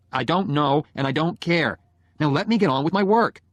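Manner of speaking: annoyed husband shutting the argument down; irritated retort. Acted. Husband snaps back in acted quarrel.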